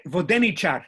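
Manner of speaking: Vodenichar, loud stress on DE; voDEnichar, stressed third syllable from the end; antepenultimate stress. Shown as a demonstration. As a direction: punch the DE in Vodenichar. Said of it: "fixed antepenultimate stress".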